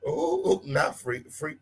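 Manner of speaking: ordinary talk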